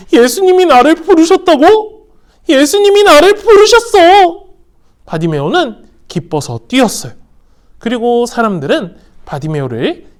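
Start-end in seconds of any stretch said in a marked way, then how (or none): 0.1-4.5 high, loud joyful disbelief; overjoyed. Acted. Bartimaeus hearing Jesus called him, overjoyed. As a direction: burst with happy surprise. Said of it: "정말 기뻤어요".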